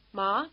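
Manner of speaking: "Ma" lifting at the end like a question; rising question. Shown as a demonstration. Teacher illustrating asking for mother, rising question on "Ma". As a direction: ask "Ma" with rising intonation. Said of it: "Think of the question".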